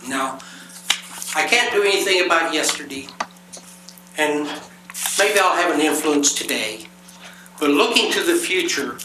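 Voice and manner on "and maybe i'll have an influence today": ordinary delivery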